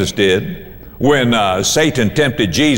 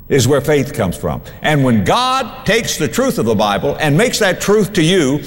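ordinary talk

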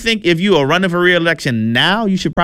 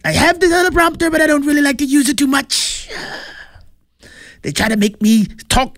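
much higher mock Trump voice; high, hammed-up impersonation of the president; Trump impression; 12 semitones higher than usual. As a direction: ham up Trump bragging about the teleprompter. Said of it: "That's what he said".

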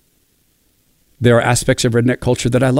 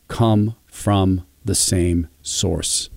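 slow and solemn; 3 semitones lower, 5 dB quieter, much slower than usual. Real, not acted. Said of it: "slow solemn line".

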